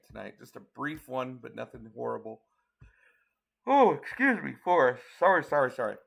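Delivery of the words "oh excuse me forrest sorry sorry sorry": startled apology. Real, not acted. Interrupted mid-broadcast, apologizes to Forrest.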